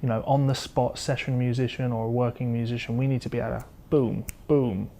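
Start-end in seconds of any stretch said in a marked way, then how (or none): none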